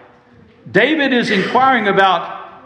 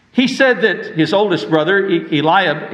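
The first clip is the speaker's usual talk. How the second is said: ordinary talk, nothing marked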